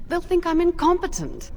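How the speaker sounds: high, loud and worried; panicked. Acted. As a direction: blurt it out in alarm. NPC confessing her fear.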